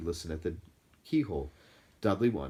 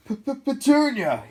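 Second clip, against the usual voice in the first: slow, stammered, high gasp; gasping in shock; 11 dB louder, much slower than usual. A narrator's reading. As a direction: choke out the name in horror. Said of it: "he gasped".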